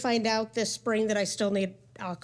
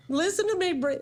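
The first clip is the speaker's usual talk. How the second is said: tearful, excited; 8 semitones higher than usual. Retold or imitated. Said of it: "all bawling and crying".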